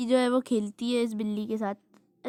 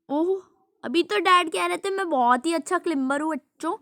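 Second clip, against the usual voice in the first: higher, louder amused disbelief; amused exclaim; 6 semitones higher, 6 dB louder than usual. Real, not acted. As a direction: gloat playfully at dad's fall. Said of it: "बहुत ही हंसी आ रही है".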